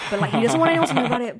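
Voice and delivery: fast, eager gossip gush; excited gossip. Acted. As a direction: rattle off the secret quickly. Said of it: "so it'd be like".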